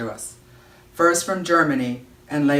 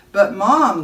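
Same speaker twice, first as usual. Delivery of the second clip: higher, louder protest; 6 semitones higher, 8 dB louder than usual. Acted. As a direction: object like a kid told to leave. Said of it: "Lauren protested".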